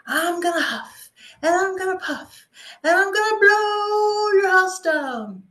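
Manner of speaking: high, loud sing-song wolf threat; storyteller's wolf, half sung and building; big bad wolf. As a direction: chant the huff and puff, swelling to the end. Wolf threat in fairy tale.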